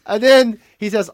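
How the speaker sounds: normal talking voice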